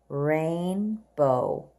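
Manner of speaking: Rainbow drawn out long and low; slow model read. Shown as a demonstration. Teacher modelling Rainbow slowly for young readers. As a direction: stretch Rainbow for the class. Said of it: "Let's read the words together".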